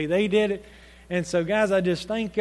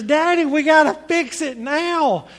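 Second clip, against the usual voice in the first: loud, high imitation of an insistent child; urgent insistence; 6 semitones higher, 5 dB louder than usual. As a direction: demand it as an impatient little girl. Retold daughter urgently insists.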